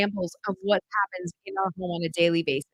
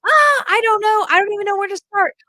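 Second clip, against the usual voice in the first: overwhelmed parent; 10 semitones higher, 7 dB louder than usual. Retold or imitated. Homeschool mom imitating a lost, overwhelmed family in a high voice. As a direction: play the parent who has no idea where to start, pitched up. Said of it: "families that are like".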